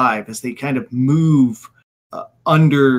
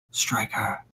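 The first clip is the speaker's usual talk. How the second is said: low, soft menacing threat; menacing villain; 4 semitones lower, 12 dB quieter than usual. Acted. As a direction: threaten him quietly and slowly. Villain orders quietly.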